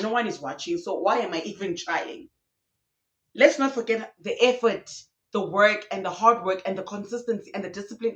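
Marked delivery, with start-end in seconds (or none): none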